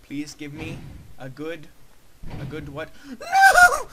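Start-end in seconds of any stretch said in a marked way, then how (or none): 3.1-3.9 very high, loud anguished scream. Real, not acted. Gamer failing the level, high anguished scream. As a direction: scream it at the top of your range.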